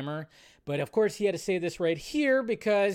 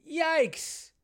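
much higher, squeaky yikes; cringing yikes; 11 semitones higher than usual. Real, not acted. Host reacting to the Gyllenhaal set report, squeaky and cringing. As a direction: recoil at the news.

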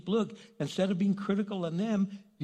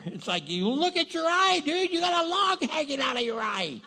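ordinary talk, nothing marked

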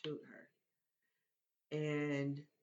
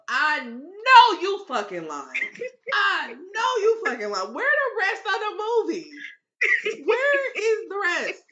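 retold outburst; 11 semitones higher, 10 dB louder than usual. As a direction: yell at the TV that the movie can't be over. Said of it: "I said out loud in the room".

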